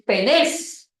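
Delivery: penés drawn out slowly, stressed on the final E; stress on last syllable. Shown as a demonstration. Teacher showing the class the transliteration, penés stressed on the final syllable. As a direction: slowly model penés with the accent at the end. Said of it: "con acento en la última".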